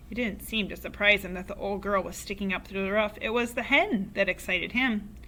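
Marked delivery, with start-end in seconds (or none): none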